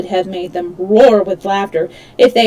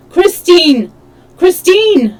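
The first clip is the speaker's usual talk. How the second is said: higher, louder anguished cries; desperate calling; 9 semitones higher, 7 dB louder, slower than usual. A narrator's reading. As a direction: call her from the stage in despair. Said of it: "calling to her".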